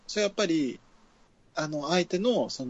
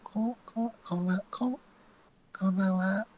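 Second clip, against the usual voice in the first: higher pitched try; 6 semitones higher than usual. Shown as a demonstration. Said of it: "キー上げましょう".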